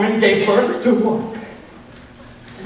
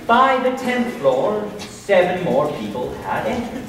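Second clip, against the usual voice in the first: ordinary delivery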